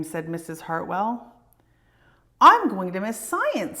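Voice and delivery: put-on lively read-aloud for children; storybook reading. A narrator's reading. Reading a picture book aloud.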